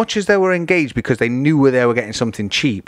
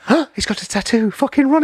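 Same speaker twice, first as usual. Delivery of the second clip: higher, mock-scared snobs; mocking alarm; 11 semitones higher than usual. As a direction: parody the people who judge tattoos. Mocking prejudiced people.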